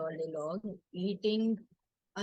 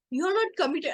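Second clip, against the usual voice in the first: high, louder mock accusation; retold scolding; 10 semitones higher, 5 dB louder than usual. Mom to daughter over workshop clothes, mock scolding. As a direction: imitate a scolding mom, pitched up.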